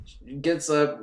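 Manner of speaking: ordinary talk, nothing marked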